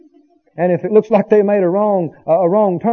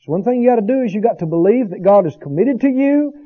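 ordinary talk, nothing marked

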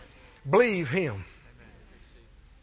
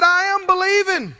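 loud, high cry of faith; 9 semitones higher, 6 dB louder than usual. Acted. Desperate father crying out to Jesus, loud declaration. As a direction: call it out at raised pitch. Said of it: "the man cried out".

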